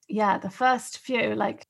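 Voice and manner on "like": normal talking voice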